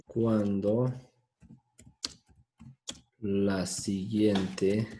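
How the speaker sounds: teacher dictating "An se usa cuando la siguiente palabra empieza" word by word with long pauses; slow dictation. Shown as a demonstration. Teacher dictating the rule to students, very slow. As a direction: drag out each word so students can write it. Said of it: "dictating the rule slowly".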